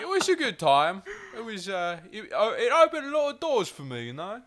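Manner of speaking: high, hammed-up Cockney with laughter around it; comic Cockney actor giving an interview; Lena Headey. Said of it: "that's what they sound like".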